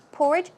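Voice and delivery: "Porridge" with the first syllable PORR hit hard; stress on first syllable. Shown as a demonstration. Teacher showing learners "Porridge", first syllable stressed. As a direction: punch the start of "Porridge". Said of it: "stress goes on the first syllable".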